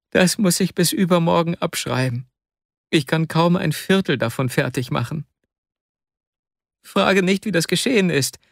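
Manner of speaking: Wasja, despairing. Acted. Wasja shows the uncopied notebooks, in despair. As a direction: admit it helplessly, pitch held high. Wasja admits unfinished work.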